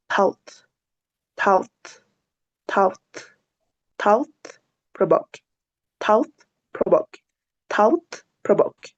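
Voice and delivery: "thought" and "provoke" alternated in short careful bursts; tongue between teeth for "thought", crisp "provoke"; drill thought, provoke. Shown as a demonstration. Teacher drills "thought" and "provoke" in turn for students. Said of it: "se llega a omitir".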